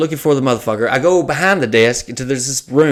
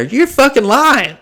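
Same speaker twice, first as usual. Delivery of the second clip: incredulous; 11 semitones higher, slower than usual. Real, not acted. Mocking the Uber guy's promise.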